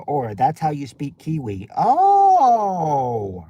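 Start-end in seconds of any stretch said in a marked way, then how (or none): 1.8-3.5 long, loud, high "oh" of realization; drawn-out oh. Real, not acted. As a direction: hold a high, loud oh, then let it slide down. Reacting to learning the Kiwi greeting.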